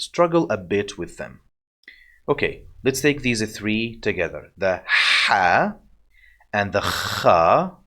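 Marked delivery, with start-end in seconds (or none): 4.6-5.8 tight, breathy h in "ha". Shown as a demonstration. Teacher introduces three letters together, breathy tight ha. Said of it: "it's the more breathy sound". 6.5-7.8 scraped, scratchy kh in "kha". Shown as a demonstration. Teacher introduces three letters together, scratchy kha. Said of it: "it's the scratchy one".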